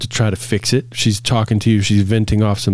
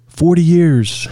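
put-on slow, higher voice of an old man answering; older husband's answer; 7 semitones higher, 3 dB louder, slower than usual. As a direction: lightly play the proud old husband. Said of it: "how long you been together".

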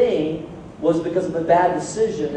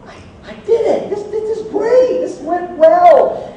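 much higher, gleeful victory squeal; triumphant; 11 semitones higher than usual. Retold or imitated. Dad alone with kids, squealing in triumph. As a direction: celebrate like you won. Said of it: "and I'm like".

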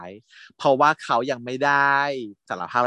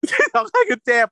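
shrill high laughing squeal, much louder; squealing exclaim; 4 dB louder than usual. Real, not acted. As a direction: squeal it out, amused. (กรี๊ดเสียงสูงขำๆ)

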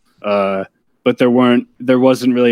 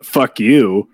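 gruff comeback. Pretend. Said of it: "just say".

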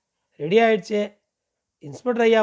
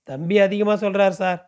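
plain reading voice

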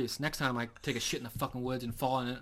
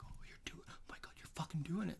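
thrilled hushed whisper; excited whisper; 6 semitones higher, 9 dB quieter than usual. Retold or imitated. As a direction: whisper it, amazed the call works. Cousins excited as gobbles answer.

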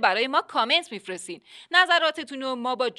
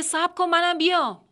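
retold shout; 6 semitones higher than usual.

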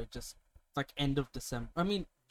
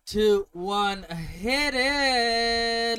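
countdown shout; 7 semitones higher, 5 dB louder, much slower than usual. Real, not acted. Countdown to start podcast.

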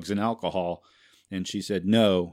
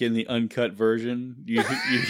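ordinary talk, nothing marked

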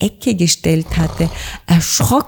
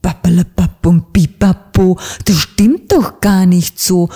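put-on louder, booming old raven rebuke; thundering; 2 semitones higher, 4 dB louder than usual. Raven councillor thunders at the stammering little bird. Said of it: "donnerte die mächtige Stimme".

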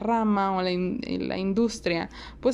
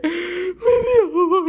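gasp; 10 semitones higher, 5 dB louder than usual. Real, not acted. Realizes half hour wasted.

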